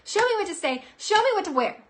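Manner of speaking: high, loud playful plea; pleading call. Acted. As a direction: beg the universe brightly. Begging the universe playfully.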